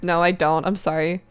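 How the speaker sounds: ordinary delivery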